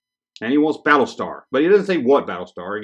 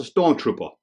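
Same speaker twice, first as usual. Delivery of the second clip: "Stormtrooper" with a New York dropped R; New York accent. Retold or imitated. Repeating the kid's misspelt wish, New York accent. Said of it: "That's how they say it up in New York".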